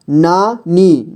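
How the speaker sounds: नानी slowly as ना, pause, नी; syllable by syllable. Shown as a demonstration. Teacher showing beginners how to read नानी, syllable by syllable. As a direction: break नानी into two long syllables. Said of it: "जोड़ जोड़ करके ही पढ़ना होगा".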